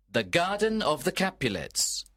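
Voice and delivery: ordinary delivery, nothing marked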